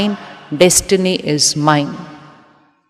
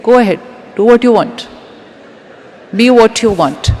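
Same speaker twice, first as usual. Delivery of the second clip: higher, more forceful release; firm declaration; 4 semitones higher, 4 dB louder, faster than usual. Shown as a demonstration. Speaker coaching listeners to say it to others, firm declaration. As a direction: say it out firmly, urging them on.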